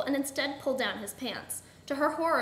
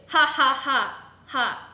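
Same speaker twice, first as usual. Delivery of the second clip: slow, loud "ha, ha" villain laugh; staged villainous laughter; evil laugh; 9 dB louder, much slower than usual. A narrator's reading. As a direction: laugh out each ha deliberately. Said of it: "laughed evilly".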